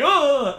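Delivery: loud, high mock grunt of falling; acted yelp as you topple forward; stumbling grunt. Acts out falling on date.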